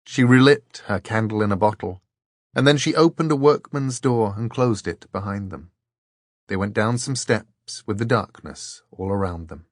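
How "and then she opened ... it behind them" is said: usual speaking voice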